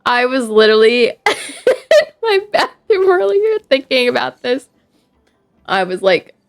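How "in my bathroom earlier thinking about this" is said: high, squeaky voice breaking into laughter; real helpless giggling through the words; crying with laughter. Real, not acted. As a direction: laugh so hard it comes out tearful. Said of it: "Look, I'm crying".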